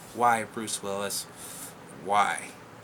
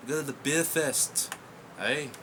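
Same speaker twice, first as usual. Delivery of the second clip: slow, put-on Scottish accent; a hammed-up Scottish attempt; amateur Scottish accent that drifts toward Canadian; 3 semitones higher, slower than usual. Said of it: "only accent I can do decently".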